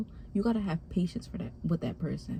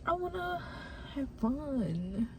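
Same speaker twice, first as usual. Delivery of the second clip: high, halting, close to tears; real tearful; 5 semitones higher, 4 dB quieter, slower than usual. Real, not acted. Speaker admits just wanting to have fun, tearful. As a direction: push the words out through real tears. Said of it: "I'm about to cry".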